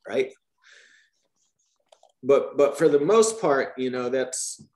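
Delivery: ordinary talk, nothing marked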